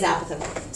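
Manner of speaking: apothem as AP-uh-thum; stress on first syllable. Shown as a demonstration. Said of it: "correct way to say it is".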